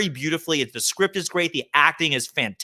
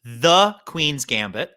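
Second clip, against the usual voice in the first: The drawn out slowly and stressed; 2 semitones lower, much slower than usual. Shown as a demonstration. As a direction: lean on The in The Queen's Gambit, slow. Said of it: "T H E".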